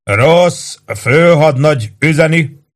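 slow, high shouted message; calling out. A narrator's reading. Chief shouting the lieutenant's message to the sergeant. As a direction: call the message up the roof. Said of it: "kiáltotta".

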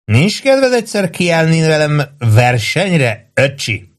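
put-on high, slow drunken boast; swooping, teasing tipsy dare; grinning challenge. A narrator's reading. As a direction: brag and goad with a grin.

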